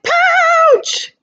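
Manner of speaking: teacher voicing scared Joey fleeing to the pouch; frightened cry. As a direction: yelp it and dive for mama. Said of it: "said Joey".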